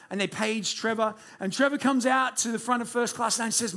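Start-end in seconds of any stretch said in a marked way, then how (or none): none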